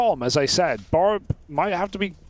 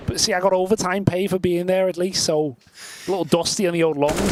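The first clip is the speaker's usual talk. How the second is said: put-on Scouse accent; 3 semitones higher than usual. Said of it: "it's a Liverpudlian Scouser accent".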